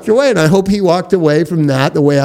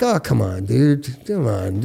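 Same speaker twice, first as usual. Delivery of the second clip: low, quiet pleading; quiet, low coaxing to a musician friend; soft coaxing; 6 semitones lower, 6 dB quieter than usual. Retold or imitated. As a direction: coax a friend gently, quietly. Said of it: "that thing of, like".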